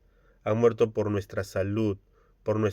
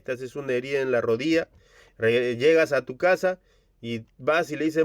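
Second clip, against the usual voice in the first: usual speaking voice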